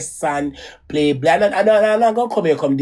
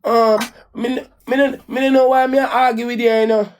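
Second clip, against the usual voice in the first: higher, pleading, retold to the ex; retold plea; 7 semitones higher than usual. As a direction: reach up high as if pleading with him again. Retelling her own plea to Jakes.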